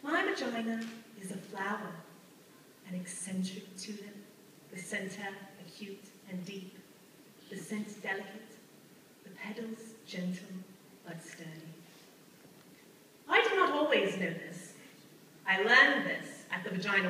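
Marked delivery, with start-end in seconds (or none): 0.0-11.5 soft, hushed, measured recitation; low, quiet voice sinking away phrase by phrase; poetic recital. Acted. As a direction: recite it like a tender poem, with pauses. Recites a lyrical description.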